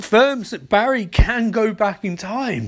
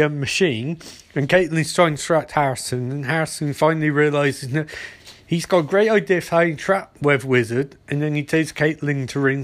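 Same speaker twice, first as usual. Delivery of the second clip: ordinary talk, nothing marked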